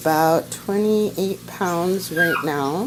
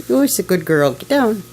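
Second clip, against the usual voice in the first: ordinary delivery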